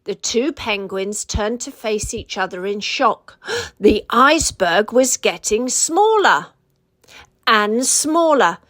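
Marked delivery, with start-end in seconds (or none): none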